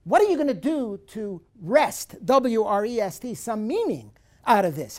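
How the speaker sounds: teacher confronting a despairing listener; challenging. Acted. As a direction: push him hard to act. Challenging the sufferer directly.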